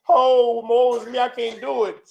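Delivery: mock the weak ones moaning; mocking whine. Preacher mocking followers who complain, in a whiny mimic. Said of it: "complain and whine and moan and lament".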